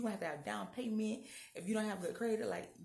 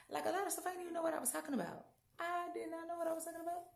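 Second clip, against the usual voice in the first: high-pitched excitement; 10 semitones higher, faster than usual. Real, not acted. Admitting she bluffed, laughing tone.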